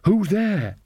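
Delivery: put-on high-pitched challenge from a wary old witch; wary questioning. A narrator's reading. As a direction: ask it high, unsure who will answer. Wary Nanny checks who is back.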